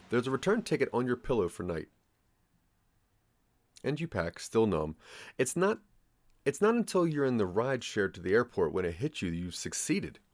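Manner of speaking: usual speaking voice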